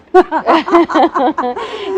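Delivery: long, high burst of giggles; high rolling laugh; real amused laughter. Real, not acted. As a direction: laugh helplessly at a fond memory. Laughing about her bratty co-star.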